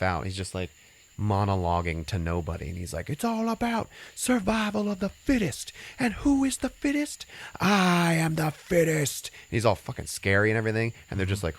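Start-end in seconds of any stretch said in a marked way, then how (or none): 3.1-9.3 high, ranting madman voice; crazed street preacher. Retold or imitated.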